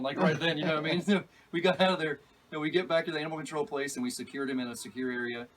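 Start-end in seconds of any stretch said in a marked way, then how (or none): none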